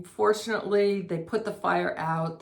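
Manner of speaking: ordinary delivery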